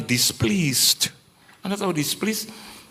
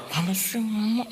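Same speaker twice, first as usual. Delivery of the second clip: higher, soft grumbling quote; mock annoyed retort; 7 semitones higher, 5 dB quieter than usual. Preacher mimics being irritated with a spouse, mock annoyed. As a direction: act out a muffled, irritated comeback.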